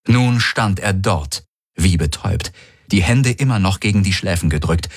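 plain reading voice

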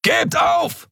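very high, strained yell; thundering shout. A narrator's reading. Scarred man demanding surrender down the corridor, thundering shout. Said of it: "donnerte ein narbengesichtiger Mensch".

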